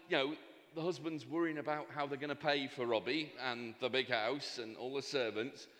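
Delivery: ordinary talk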